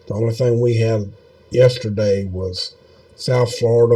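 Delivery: normal talking voice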